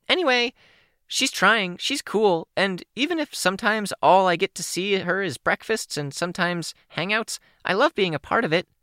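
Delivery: put-on high, bubbly teen girl voice; bright young girl voice, a little shy; Kenzie's voice. Kenzie speaks.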